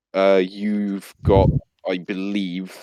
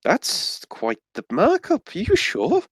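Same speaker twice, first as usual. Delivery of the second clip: put-on high, slow, startled old lady; surprised granny; 12 semitones higher, slower than usual. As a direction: raise the voice in shocked disbelief. Granny shopkeeper reacts to haggling.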